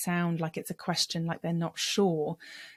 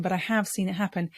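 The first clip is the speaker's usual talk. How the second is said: usual speaking voice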